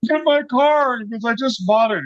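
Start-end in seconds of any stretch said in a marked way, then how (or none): 0.1-1.0 mock scream. Owner screaming over wrecked car, mock outrage. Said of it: "he starts screaming at me". 1.2-2.0 high-pitched retold outrage; mock frantic outrage. Owner losing it over new car, mock frantic. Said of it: "He's losing his mind".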